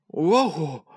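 high-pitched yelp of alarm; startled cry. A narrator's reading. Tom waking inside the cow's mouth, startled cry. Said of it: "小汤姆叫了起来".

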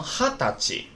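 哈达吉 slowly, each syllable set apart; special reading. Shown as a demonstration. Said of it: "这是特殊读音". (哈达吉，特殊读音，慢慢读清)

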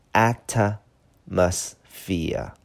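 "atmosphere" slowly in separate syllables, leaning on the first; stress on first syllable. Shown as a demonstration. Teacher shows learners where the weight falls, front-stressed "atmosphere". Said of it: "它的重音是在前边".

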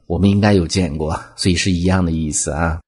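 normal talking voice

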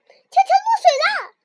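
alarmed shout. Acted. Frog sees friend drowning.

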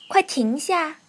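a storyteller's bossy duck cry; calling out. A narrator's reading. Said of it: "鸭子大叫起来". (大声喝止)

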